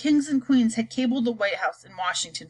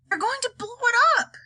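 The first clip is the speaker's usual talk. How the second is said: high panicked yell; 13 semitones higher than usual. Acted. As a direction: yell the alarm. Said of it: "yelled ground control".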